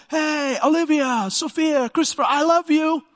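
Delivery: high, loud call across a crowded hallway; yelling down hallway. Retold or imitated. Parent yelling love to teens down the school hallway, loud high call. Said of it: "it's uncool to yell down the hallway".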